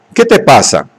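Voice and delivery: a mild, understated near-shriek of fear; a touch of a frightened shriek. Acted. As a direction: let the question edge toward a squeal. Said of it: "dijo casi en un chillido".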